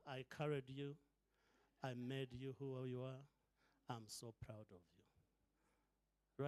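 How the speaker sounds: soft, fading mother's whisper of pride; proud mom. Retold or imitated. Mom's quiet pride at her daughter speaking, softly. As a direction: hush it like a mother near tears. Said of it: "I saw mom going".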